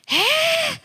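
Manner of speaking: high, startled "Hey?" jumping way up; a loud startled yelp; surprised. Retold or imitated. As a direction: squeak it out in shock. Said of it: "they were so surprised".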